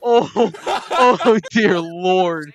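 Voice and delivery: slow laughing exclamation. Real, not acted. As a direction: laugh through the words. Laughing after a fright.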